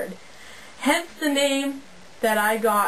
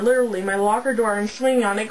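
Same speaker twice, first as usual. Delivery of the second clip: usual speaking voice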